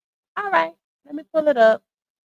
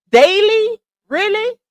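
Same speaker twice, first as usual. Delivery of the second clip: high, sarcastic double-take on Daily and Really; incredulous disbelief; 9 semitones higher, slower than usual. Acted. Mocking a fundraiser's claim to viewers, in high incredulous disbelief. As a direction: scoff as if the claim is absurd.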